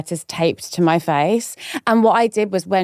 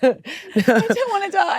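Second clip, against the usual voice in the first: mock terror; 11 semitones higher, slower than usual. Co-host joins jet ski bit.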